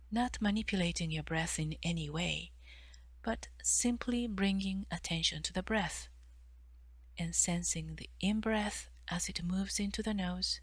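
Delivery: usual speaking voice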